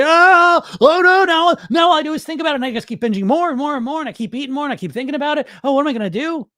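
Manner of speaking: high panicky worrier voice; mock panic. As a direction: spiral into a hammed-up freakout. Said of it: "you can't be in control of candy".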